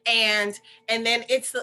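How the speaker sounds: usual speaking voice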